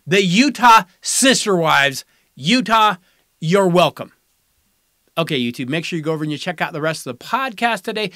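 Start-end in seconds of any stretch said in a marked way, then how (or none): 0.1-4.1 slow, louder grand unveiling; proud reveal. Acted. Host unveiling his joke team name as a big reveal. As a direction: present it like the big finale. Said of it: "I'm just gonna say it".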